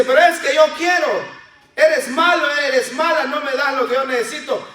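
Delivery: put-on a spoiled kid whining at the parent, fast; whining child. Child whining at parent who refused ice cream, high and fast.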